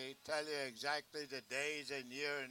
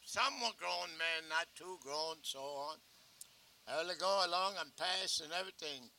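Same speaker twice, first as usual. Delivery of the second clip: usual speaking voice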